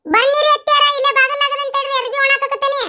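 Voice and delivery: high put-on voice calling from outside; calling back. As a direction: answer from a distance in a high voice. (ಹೊರಗಿನಿಂದ ಎತ್ತರದ ಧ್ವನಿಯಲ್ಲಿ ಉತ್ತರ)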